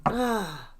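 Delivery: ordinary delivery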